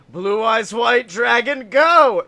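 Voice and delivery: loud, slow, rising summoning shout; mock battle cry. [name] summoning a monster in a card game, mock battle cry. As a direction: build to a huge shout on "go".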